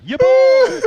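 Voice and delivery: loud, high celebratory shout; triumphant boast. Acted. Comic celebrating dodging jail, loud high triumphant shout. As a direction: belt it out way up high.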